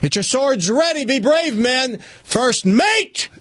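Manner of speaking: high rousing call to the crew; rally the men to battle; captain rallying his outnumbered crew. Acted.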